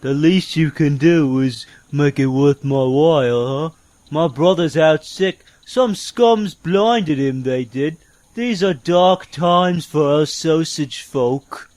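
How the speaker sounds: low, slow gruff peddler; gruff sausage vendor. Acted. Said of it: "I don't understand a word that guy said".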